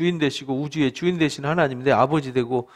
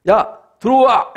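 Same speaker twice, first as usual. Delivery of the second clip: calling in; 5 semitones higher, 6 dB louder, slower than usual. Retold or imitated. Council calls apostles back in.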